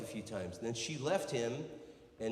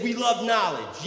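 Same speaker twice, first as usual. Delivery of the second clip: loud, high, slow model shout; feed the line at a drawn-out yell; 9 semitones higher, 11 dB louder than usual. Shown as a demonstration. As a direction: holler it for someone to repeat. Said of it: "Yell it, try it now".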